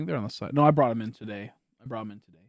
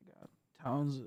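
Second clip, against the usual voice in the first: ordinary talk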